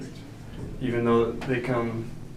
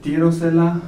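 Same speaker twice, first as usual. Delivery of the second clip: "Tiro se la" said higher and louder as the bad version; wrong word order example; 5 semitones higher, 9 dB louder than usual. Shown as a demonstration. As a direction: call out "Tiro se la" as the wrong form. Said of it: "that would just sound bad".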